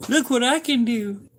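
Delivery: put-on very high, excited kid voice; high little-kid brag to his wife; childlike show-off. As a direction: squeal it like a proud little kid showing off. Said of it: "be like".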